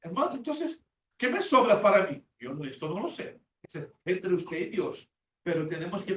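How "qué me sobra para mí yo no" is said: normal talking voice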